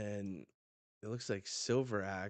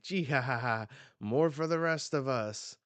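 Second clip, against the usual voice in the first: acted cackle into a loud gloat; high, loud villainous gloating; gloating villain laugh; 5 semitones higher, 7 dB louder than usual. Shiki gloats after the bomb explodes, gloating laugh.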